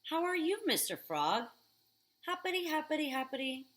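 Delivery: high bouncy cheerful greeting; excited kid. Acted. Boy greeting the frog, bouncy and high.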